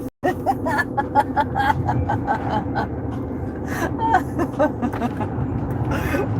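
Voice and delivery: long breathy laughing fit; breathless laugh. Real, not acted. Driver cracks up at a viewer's comment, long airy laughing fit.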